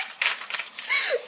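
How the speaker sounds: silent wheezing laugh; high breathless laugh. Real, not acted. Reader wheezing after the plan C line, high breathless laugh. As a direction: laugh with no voice left.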